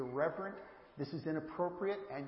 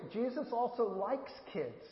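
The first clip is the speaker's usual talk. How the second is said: likes lifted and slowed, said higher than the rest; stress on likes; 7 semitones higher than usual. Shown as a demonstration. Pastor to the kids in church, stressing likes. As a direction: lean on likes, slow and high, so the difference is heard. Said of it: "Do you hear the difference?".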